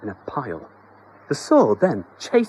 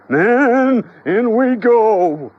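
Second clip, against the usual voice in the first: much higher and louder, slow rallying shout; battle cry; 11 semitones higher, 7 dB louder, much slower than usual. Acted. Exterminator rallies his men, shouting. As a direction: rouse the troops.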